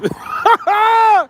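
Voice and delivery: high burst of laughter. Real, not acted. Laughs mid-story.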